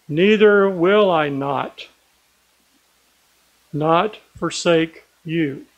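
slow, weighty, every "not" driven home; slow emphatic scripture recital, each "will not" stressed; emphatic reading. Shown as a demonstration. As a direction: lay each denial down deliberately, building conviction. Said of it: "Pretty emphatic".

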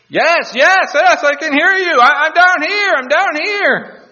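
very high, loud reply to a rescuer; frantic calling out. Acted. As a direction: shout desperately from down a cliff. Cliff hanger answers the voice.

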